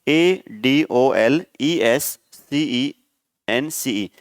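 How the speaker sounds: "A D O L E S C E N C E" dictated very slowly, each letter apart; drawn-out dictation of "A D O L E S C E N C E"; slow spelling. Shown as a demonstration. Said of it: "यहाँ पे सी लिखना है आपको".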